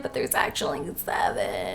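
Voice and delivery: sheepish whisper; whispered confession. Acted. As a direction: mutter the bad news.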